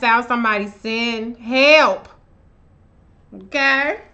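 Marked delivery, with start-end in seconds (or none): none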